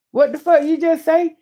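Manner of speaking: high, sharp what-did-you-say repeat; blunt mock retort. Shown as a demonstration. Repeating how to answer her cousin's husband, blunt. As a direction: fire it back, incredulous. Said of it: "And say it just like that".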